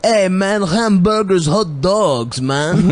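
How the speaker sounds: high, lightly mocked Greek cousin doing American; cousin's American accent. Mocking the Greek cousin's fake American accent to friends. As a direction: play a Greek faking American slang. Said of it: "trying to do an American accent".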